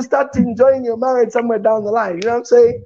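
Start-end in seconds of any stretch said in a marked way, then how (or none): none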